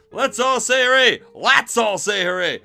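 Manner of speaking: ordinary delivery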